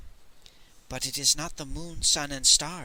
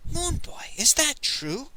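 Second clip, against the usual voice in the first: skeptical; 11 semitones higher, slower than usual. Acted. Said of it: "said skeptically".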